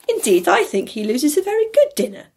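high, loud cut-in; interrupting. A narrator's reading. Niece cuts in about the dinner, high and loud. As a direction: jump in over him. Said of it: "interrupted Scrooge's niece".

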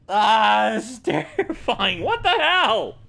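shaky nervous laugh, high; laughing off fear. Real, not acted. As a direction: laugh through the fright. Nervous laughter after scare.

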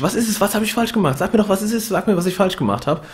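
hectic questioning. Retold or imitated. Alex demanding answers from his girlfriend, hectic. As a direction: fire the questions in a panic. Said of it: "fragt er halt so hektisch".

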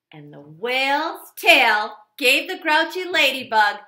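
ordinary delivery, nothing marked

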